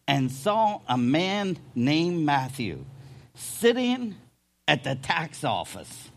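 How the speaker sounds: normal talking voice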